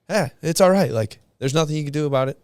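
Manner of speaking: dismissive shrug-off. Retold or imitated. To his wife dwelling on problems, dismissive. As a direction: wave the problem away, get over it. Brushing off wife's worries.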